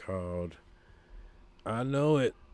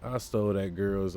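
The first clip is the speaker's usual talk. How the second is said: ordinary delivery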